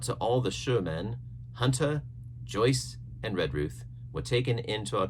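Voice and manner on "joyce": usual speaking voice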